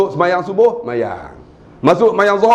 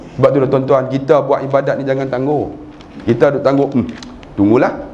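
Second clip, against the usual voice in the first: ordinary talk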